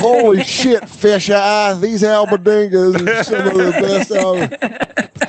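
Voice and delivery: mock amazement. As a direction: gush over the meatballs in jest. Acts amazed at the meatballs.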